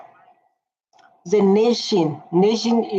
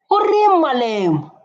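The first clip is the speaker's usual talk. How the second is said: calling out; 8 semitones higher than usual. Real, not acted. Calling out to Malema on screen.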